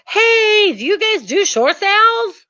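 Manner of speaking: high, chirpy clueless newbie; naive beginner. Retold or imitated. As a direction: ask like an eager first-timer. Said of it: "you probably said something like".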